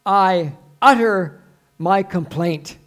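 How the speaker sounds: loud, slow, weighty complaint; declaimed lament. Acted. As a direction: utter it aloud, drawing out each word. Declaiming the verse at volume.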